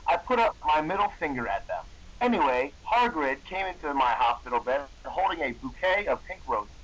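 ordinary delivery

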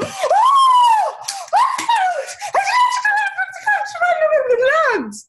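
high, loud squealing mock Polish gibberish; retold excited squeal. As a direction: imitate little girls shrieking with glee. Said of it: "they go".

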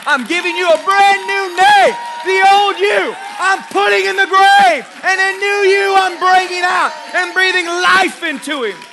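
shouted high preaching climax; full-force preacher shout. Acted. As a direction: thunder God's promise. Preacher voicing God, peak.

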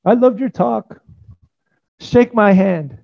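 high-pitched put-on admirer; acted guard, pitched well above normal; Nazi guard in retold story. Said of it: "And the guard came up, didn't recognize her".

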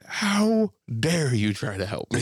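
slow, drawn-out indignant cry; mock outrage. Offended driver to a would-be helper, mock outraged. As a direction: stretch it out like a grand accusation.

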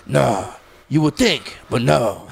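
lightly voiced deadpan comeback, slow and a little low; slow, understated impression of a dry reply; Pacino. Pacino answering Jill about the broken Oscar, lightly imitated and dry. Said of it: "And he goes".

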